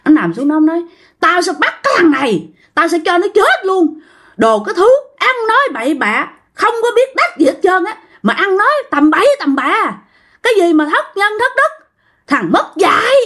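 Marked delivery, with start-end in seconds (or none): 1.2-13.1 high-pitched indignant tirade, not shouted; angry cursing. Retold or imitated. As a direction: curse him out as an offended old ghost. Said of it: "Chửi vậy đó". (giọng chửi mắng bức xúc, lên cao)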